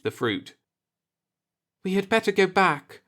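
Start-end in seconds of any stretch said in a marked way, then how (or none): 1.8-3.0 higher woman's voice, uneasy; lightly voiced woman, uneasy; Marla uneasy. A narrator's reading. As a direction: urge him to leave, a little worried. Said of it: "Marla said".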